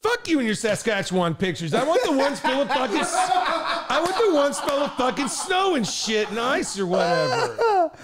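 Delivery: high-pitched rant cracking up with laughter; laughing mock outburst. Comic ranting at co-host about Saskatchewan pictures, laughing mock outburst. Joking retort amid laughter.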